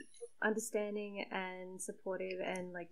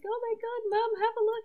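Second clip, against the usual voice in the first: high excited squeal, growing louder; excited seventeen-year-old; 8 dB louder than usual. Retold or imitated. Teen showing mum her webcomic feature, excited. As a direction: squeal it like a thrilled teenager. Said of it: "which was very exciting for, like, seventeen-year-old me".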